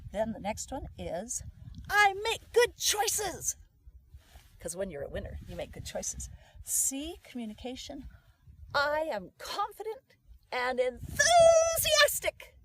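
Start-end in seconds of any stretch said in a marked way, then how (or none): 1.9-3.6 high, very slow, booming declaration; loud affirmation. Shown as a demonstration. Coach demonstrates the next affirmation, loud and drawn out. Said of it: "you have to say it loud". 8.7-12.5 building to a loud, high, stretched 'enthusiastic'; enthusiastic. Shown as a demonstration. Coach models the communication affirmation, wildly enthusiastic.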